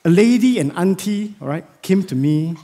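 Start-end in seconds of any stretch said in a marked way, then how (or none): none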